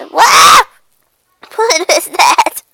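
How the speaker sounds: loud, high startled cry. Acted. Cat spooked by an unknown thing, startled. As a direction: burst out in alarm, then stretch the question.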